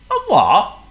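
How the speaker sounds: high, loud cheery northern shopkeeper. Acted. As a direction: boom it out like a jolly shopkeeper. Shopkeeper asks.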